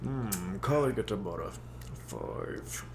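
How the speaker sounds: words squeezed through clenched teeth; through gritted teeth. Acted. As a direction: keep your jaw shut and talk. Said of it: "Speak through your teeth".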